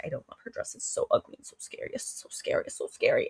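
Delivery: hushed, whispered mock fright; scared whisper aside. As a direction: whisper it like a frightened secret thought. Acting Samantha's private scared thoughts.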